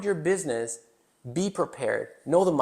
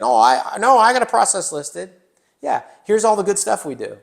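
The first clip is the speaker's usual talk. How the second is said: proud retort; 6 semitones higher, 7 dB louder than usual. Retold or imitated. Mock business owner boasting.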